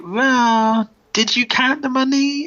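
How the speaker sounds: mock sly retort. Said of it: "And he's like".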